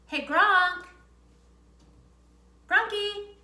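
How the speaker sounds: loud, high call to a pet; calling the dog. Real, not acted. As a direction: shout the dog's name cheerfully. Calling her dog over.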